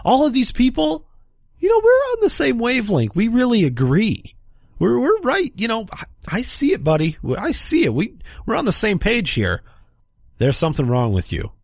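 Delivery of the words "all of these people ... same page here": much higher, sing-song parody of a self-satisfied city liberal; mocking smug liberal New Yorker. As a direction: ham up a preening New Yorker, pitched way up. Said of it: "you're like".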